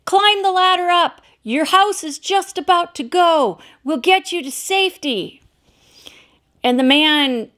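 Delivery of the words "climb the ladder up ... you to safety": higher-pitched call from the helicopter; shouting down. Retold or imitated. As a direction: shout over the rotor noise. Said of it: "they shouted through the blowhole".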